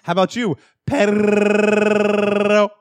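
high, loud mock Spanish guy; Spanish accent. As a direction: ham up a Spanish accent, rolling R. Mocking Spanish character on show.

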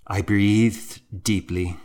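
plain reading voice

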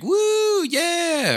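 very high, whooping imitation of a crowd; mock the MPs' cheering as silly and over the top; squealing parody of a cheering crowd. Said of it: "they all start cheering".

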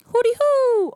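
high hooting signal call; imitated street call. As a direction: sing out the call like a lookout. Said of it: "and he's like".

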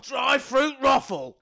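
mock shout. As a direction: bellow Drive-through brothel as a joke. Shouted callback joke.

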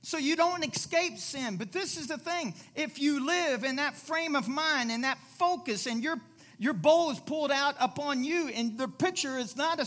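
loud, driving sermon delivery; preaching. Acted. Pastor urging the congregation to stop focusing on self, preaching loudly.

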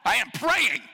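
high, loud snap mid-prayer; hammed-up furious outburst; mock angry shout. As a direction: yell at someone while pretending to pray.

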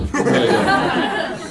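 drawn-out very slow dad approval; imitating dad. Son imitates his father's slow approving reply. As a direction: stretch it slowly like a bemused dad. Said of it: "our father, who always responds".

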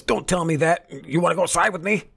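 high, hammed-up challenge; squeaky mock picking a fight; imitating Biden daring the worker to step outside. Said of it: "Biden goes".

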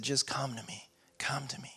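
soft and hushed, sinking to a whisper on the repeat; gentle voice of God. Acted. Preacher voicing God's quiet invitation to the thirsty, hushed whisper. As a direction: softly call them close, almost breathing the words.

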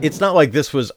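ordinary talk, nothing marked

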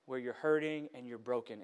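ordinary talk

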